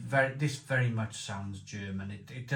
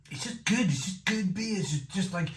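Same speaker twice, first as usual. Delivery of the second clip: gushing delight; 7 semitones higher than usual. Real, not acted. Gushing over the beer.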